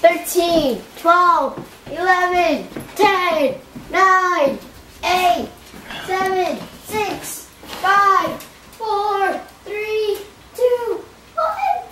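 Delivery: high eager countdown; excited countdown. Real, not acted. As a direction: count down the last seconds. Son counting down timer.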